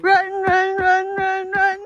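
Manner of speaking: loud playful run call; yelling while running away. Pretend. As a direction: shout it like fleeing a monster. Mock fleeing dinosaur with kids.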